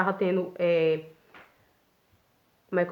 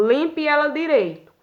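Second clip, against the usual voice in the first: higher-pitched, insistent mock command; imitated scolding order; 6 semitones higher than usual. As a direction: mimic a boss demanding it be redone. Retold boss's sharp order. (ordem de chefe, imitada em tom mais agudo)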